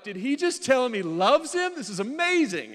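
high, loud gush of amazement; gushing excitement. Retold or imitated. Husband secretly hooked on a romance movie, gushing. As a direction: blurt it out, completely swept up. Acted inner voice gripped by movie.

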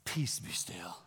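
hushed whisper to the storm; whispered command. Retold or imitated. Jesus calming the storm in a whisper. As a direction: breathe it out like a secret. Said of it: "and he says this".

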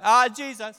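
high, loud call across the water; yelling back. Retold or imitated. Disciple calling to another boat, high and loud. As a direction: shout the answer back to the other boat. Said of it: "somebody yelling back".

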